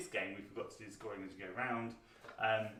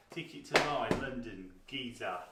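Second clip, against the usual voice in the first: playful Cockney accent, louder; 6 dB louder than usual. Shown as a demonstration. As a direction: say it like a London Cockney geezer. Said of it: "Cockney Ryman slang".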